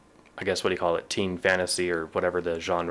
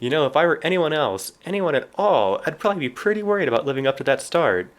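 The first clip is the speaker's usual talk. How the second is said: smug Harry voice; 8 semitones higher, 4 dB louder than usual. Acted. Reading Harry's smug book quote.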